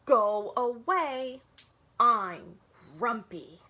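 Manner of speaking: slow, loud grumpy grumble; slow, loud cross zebra voice. Acted. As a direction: push the elephant away slowly and crossly. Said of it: "Zebra did not want to look".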